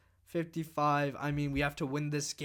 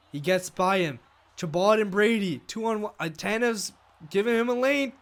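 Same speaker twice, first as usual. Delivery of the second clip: building louder and higher as the rush develops; excited build-up, higher and louder; rising excitement; 5 semitones higher, 7 dB louder than usual. Real, not acted. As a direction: call the two-on-one, getting louder. Rush up ice.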